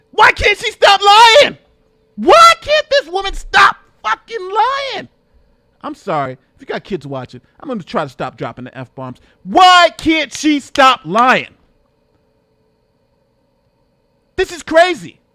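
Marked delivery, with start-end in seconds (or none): 0.1-5.1 high, slow, furious yell; exasperated shout. Real, not acted. Streamer fed up with the guest's lies, shouting. 9.4-15.2 very slow, high drawn-out yell; exasperated shout. Real, not acted. Streamer fed up with the guest's lies, shouting.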